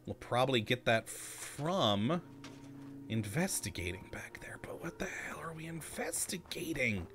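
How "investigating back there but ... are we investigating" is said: breathy unvoiced whisper to himself. Real, not acted. Gamer whispering a puzzled aside to the stream. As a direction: whisper it, half to yourself. Muttering aside while exploring.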